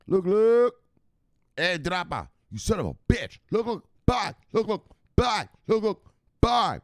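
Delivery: high, loud catchphrase farewell; hammed-up sign-off. Streamer hyping up a chatter, high catchphrase call. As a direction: call out look look bye to the chat.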